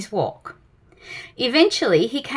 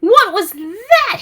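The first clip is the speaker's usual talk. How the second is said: high, slow, frightened shriek; 9 semitones higher, 6 dB louder, slower than usual. A narrator's reading. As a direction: shriek in terror. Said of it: "he shrieked".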